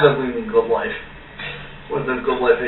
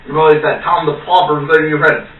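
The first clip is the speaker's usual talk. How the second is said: ordinary delivery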